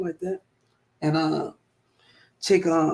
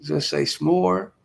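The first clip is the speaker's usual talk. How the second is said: Just say s'more stretched out slowly; slow correction; much slower than usual. Shown as a demonstration. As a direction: spell out s'more deliberately. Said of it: "let me say".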